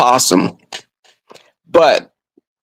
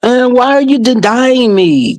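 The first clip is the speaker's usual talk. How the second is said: higher-pitched, pleading mock whine; imitated whine; 7 semitones higher than usual. Drunk friend protests being turned down, mock whine. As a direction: whine like a rejected drunk suitor. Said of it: "He starts to whine".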